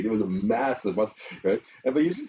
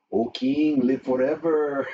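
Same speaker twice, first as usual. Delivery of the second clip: put-on higher-pitched, stretched-out East L.A. accent; 5 semitones higher, 3 dB louder, much slower than usual. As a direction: imitate a preacher putting on an East L.A. accent. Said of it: "putting on an East L A accent".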